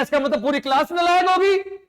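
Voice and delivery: outraged father shouting at son; retold angry shout. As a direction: yell it like a fed-up father.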